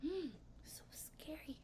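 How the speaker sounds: whispered "so scary"; mock scared whisper. Real, not acted. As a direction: breathe it out as mock fright. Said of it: "whispered mock fear".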